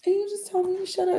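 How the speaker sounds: small, high, put-on pout; mock hurt. Acts out wounded reply to partner.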